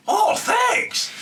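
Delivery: high, loud mock yell of thanks; hammed-up furious thank-you; mock angry shout. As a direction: bark the thanks like he'll kill you. Angry guy jumps up yelling.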